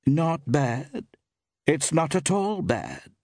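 high, measured woman's voice; shopkeeper woman. A narrator's reading. Woman shopkeeper rates the inn for a traveller, high-pitched. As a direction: pitch it high and take your time.

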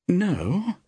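high, wavering single-word reply; uncertain. A narrator's reading. Actress asked about making a film, uncertain. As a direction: answer as if unsure of it. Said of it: "replied Iris uncertainly".

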